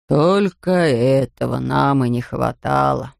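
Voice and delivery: low, slow grumble; grumbling into the mic. A narrator's reading. Factory owner grumbles into microphone at ceremony, low slow grumble. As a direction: mutter it low and slowly, fed up. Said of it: "буркнул он в микрофон".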